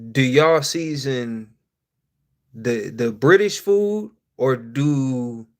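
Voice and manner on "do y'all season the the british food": slow, deliberate, each word spaced; slow pointed question. Real, not acted. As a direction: drag out the question for emphasis. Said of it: "asking the chat".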